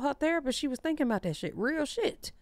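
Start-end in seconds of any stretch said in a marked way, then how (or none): none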